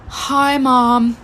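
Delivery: feebly acted sick greeting after a heavy sigh; dramatic fake illness. Daughter faking illness greets mom at school, dramatic weakness. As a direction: imitate a child overplaying being ill. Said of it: "like, so dramatically".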